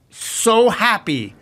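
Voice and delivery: "so happy" stretched slow and pitched high; gushing emphasis. Real, not acted. Guest raving about a movie he loved, "so happy" drawn out. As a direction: draw out "so happy" with delight.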